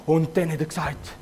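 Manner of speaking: ordinary delivery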